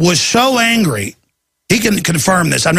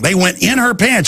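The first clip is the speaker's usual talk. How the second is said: ordinary talk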